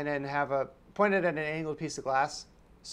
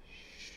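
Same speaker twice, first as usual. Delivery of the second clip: soft, breathed shushing sound; librarian's shush; 22 dB quieter than usual. Retold or imitated. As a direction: hush them like a librarian. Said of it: "the librarian goes".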